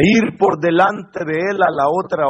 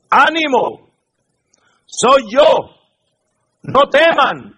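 reassuring call; 7 semitones higher, 5 dB louder than usual. A narrator's reading. Jesus calms frightened disciples.

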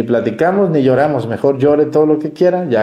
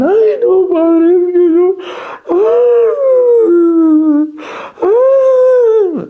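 much higher, louder hammed-up wailing sob; mock sobbing woman; 5 dB louder, much slower than usual. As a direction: imitate a weeping parishioner who can't stop crying. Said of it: "la voy a dejar aquí un rato para que llore".

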